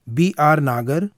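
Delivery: usual speaking voice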